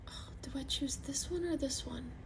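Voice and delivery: hushed, pondering whisper; soft breathy aside while picking a color. Real, not acted. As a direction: whisper it to yourself while choosing. Whispers while deciding between shades.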